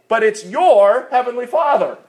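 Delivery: "your" pushed high and loud; stress on YOUR. Shown as a demonstration. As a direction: punch "your" to make it personal. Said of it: "emphasis on the next word".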